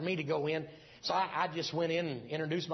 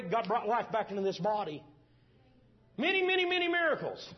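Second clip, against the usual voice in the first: much higher and louder triumphant proclamation; preaching climax; 8 semitones higher, 4 dB louder than usual. Acted. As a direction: ring it out to the congregation.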